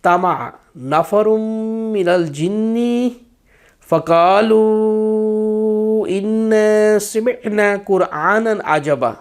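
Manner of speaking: slow, measured chanted recitation; teacher demonstrating careful Quran reading. Shown as a demonstration. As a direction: recite each word slowly and clearly. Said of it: "میں نے جس طرح پڑھا ہے آرام آرام سے".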